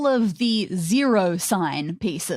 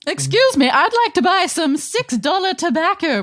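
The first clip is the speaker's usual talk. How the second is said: higher-pitched sketch customer; interrupting customer; 5 semitones higher than usual. Acted. Customer asking the shopkeeper for tobacco, higher put-on customer voice. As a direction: play a customer piping up to be served.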